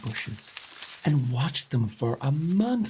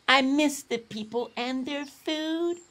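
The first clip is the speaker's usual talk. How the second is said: soft moan; 11 semitones higher than usual. A narrator's reading. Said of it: "Ai Ai would softly moan".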